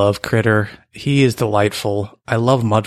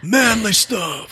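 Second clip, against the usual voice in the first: high, slow, hammed-up macho voice; mock macho voice; 12 semitones higher, much slower than usual. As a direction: drawl it like a tough guy. Joking about biker gear.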